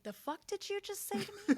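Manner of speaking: high, loud indignant snap; mock outraged. Mom voicing the reply she holds back. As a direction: play up the offended parent. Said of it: "a beat to pause and be like".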